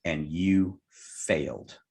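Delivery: slow, spaced out, landing on you failed; slow punchline. Retold or imitated. Hypnotist spells out the missed cigarettes, slow and deliberate. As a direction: stretch it out, letting each figure sink in.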